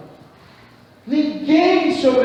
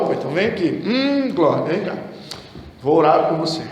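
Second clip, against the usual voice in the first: lower, put-on pious summons to prayer; mock prayer minister; 6 semitones lower, 4 dB quieter, faster than usual. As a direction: play the pious pray-er, lower and lightly. Mimics eager praying over someone.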